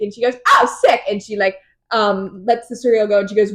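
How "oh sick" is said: high squeaky "Oh, sick" with a sharp rise on oh; mock excited oh. Feyre brushing off the Suriel, squealed "Oh, sick". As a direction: playfully squeal the reaction. Retelling Feyre's flippant reply.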